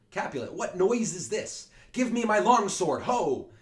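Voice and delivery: loud, high demand for his sword; old lord shouting for his long sword; blustering old man. Acted. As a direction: bellow for your sword, old and impatient. Voicing Old Capulet calling.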